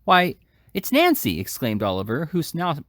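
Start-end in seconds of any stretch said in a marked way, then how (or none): 0.0-1.4 astonished. A narrator's reading. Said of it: "irrepressible astonishment".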